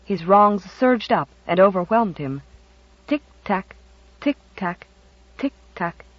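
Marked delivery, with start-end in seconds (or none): 3.1-6.0 soft, measured tick-tack with pauses; ticking grandfather clock. A narrator's reading. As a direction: imitate a deliberate old clock. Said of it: "went the old grandfather clock".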